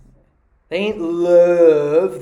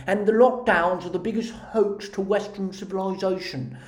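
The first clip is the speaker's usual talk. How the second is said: mocking British accent through big lips; Katie Hopkins; 3 semitones higher than usual. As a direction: talk British as if your lips are too big. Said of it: "makes her talk like this".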